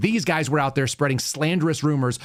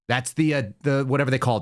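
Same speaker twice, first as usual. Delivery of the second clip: normal talking voice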